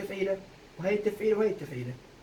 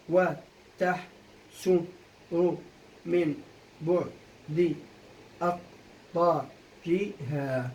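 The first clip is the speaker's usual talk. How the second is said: each syllable alone, gaps between; syllable-by-syllable scansion; 2 semitones lower, much slower than usual. Shown as a demonstration. Teacher finishing the verse's scansion for students, syllable by syllable. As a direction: chop the line into metrical units. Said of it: "هسا أول سؤال كالعادة قطع".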